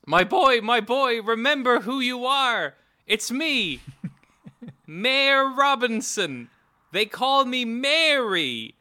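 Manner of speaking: high, hammed-up pleading old mayor; over-the-top high mayor begging his son.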